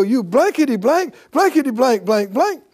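loud, rapid-fire pretend cursing; hammed-up fit of temper; mock angry cussing. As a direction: cuss out a broken lawnmower in make-believe fury. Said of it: "you're mad at the lawnmower".

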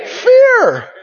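high, loud exclaimed answer; emphatic correction. Real, not acted. Preacher correcting what disciples were filled with, emphatic cry. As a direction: cry the word out high and forcefully.

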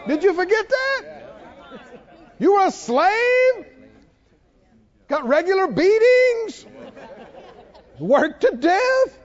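high, rising disbelief; incredulous rebuke. Acted. Preacher confronts Israelites who forgot slavery, incredulous. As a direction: throw indignant questions back at the complainers.

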